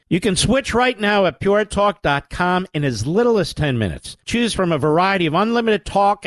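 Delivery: upbeat sponsor read. Acted. Host reading sponsor ad to listeners, upbeat pitch. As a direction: sell the plan brightly to listeners. Reads an ad.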